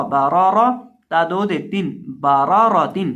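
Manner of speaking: loud, chanted letter-by-letter drill; Quran spelling recitation. Acted. Teacher chanting the letters so students repeat.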